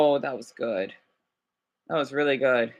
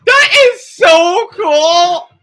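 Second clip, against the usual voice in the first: amazed; 8 semitones higher, 7 dB louder, much slower than usual. Real, not acted. Amazed reaction to robot video.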